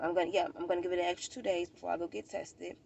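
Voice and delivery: ordinary delivery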